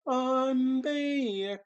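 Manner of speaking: very high, loud, drawn-out catchphrase impression; Joey's World Tour. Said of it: "That's what Joey's World Tour used to say".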